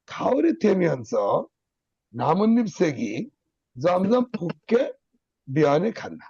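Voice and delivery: খাওরি থেমে আনছ, নামন্নিপ সেগি, জমজম ফুককে, বিয়ানে খান slow with a stop after each; four clear pauses. Shown as a demonstration. Teacher showing students where to pause, slow and deliberate. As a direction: read slowly, pausing only at the four breaks. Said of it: "লে side দিয়ে দম নিছি".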